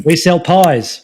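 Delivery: slow, loud, firm correction; emphatic correction. Real, not acted. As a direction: spell it out slowly and loudly. Correcting the mangled company name.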